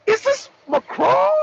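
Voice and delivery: high, startled mock Putin; surprised Putin. Putin picking up Macron's call, startled and high. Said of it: "He must have been like".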